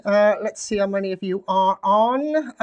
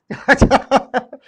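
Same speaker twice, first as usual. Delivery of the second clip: burst of laughter; 6 semitones higher, 9 dB louder than usual. Real, not acted. Startles a viewer, laughs.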